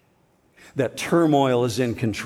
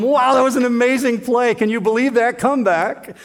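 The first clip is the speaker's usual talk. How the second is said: high, louder gushing over the play; excited text; 9 semitones higher, 4 dB louder than usual. Retold or imitated. Teasing a traveling friend by text, mock-excited gush. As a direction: hype up the amazing comeback. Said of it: "And I said to him".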